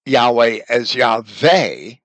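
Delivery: "Yahweh as Yahweh" said slowly, W first, then V; second Yahweh with a V sound, slow; Judean pronunciation. Shown as a demonstration. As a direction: contrast Yah-way with Yah-VAY. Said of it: "if a Judean had pronounced".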